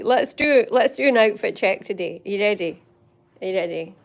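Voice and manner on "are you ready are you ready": ordinary talk, nothing marked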